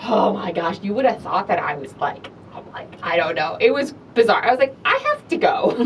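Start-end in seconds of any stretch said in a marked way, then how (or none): 4.8-5.8 nervous exit. Retold or imitated. Fleeing an awkward petition crowd.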